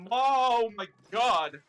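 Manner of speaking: excited. Acted. Luigi thrilled by magazine feature.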